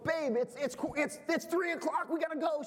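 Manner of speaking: rushing the wife to leave; urgent plea. Retold or imitated. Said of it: "I go".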